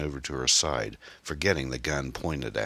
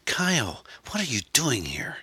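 high, startled woman's voice; shocked, high-pitched outburst; alarmed cry; 10 semitones higher than usual. Acted. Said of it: "she cried".

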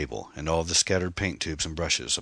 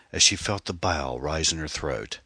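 usual speaking voice

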